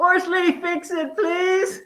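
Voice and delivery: high-pitched playful plea to husband; put-on high wheedling voice; mock pleading. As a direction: call out sweetly high, hamming up the request. Acts out asking husband for help.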